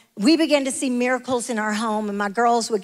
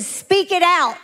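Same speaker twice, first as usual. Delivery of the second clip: higher, raised-voice retold command; emphatic command; 7 semitones higher, 4 dB louder, slower than usual. Holy Ghost tells the whispering woman to speak out, raised command. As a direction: urge it out firmly. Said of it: "the Holy Ghost said".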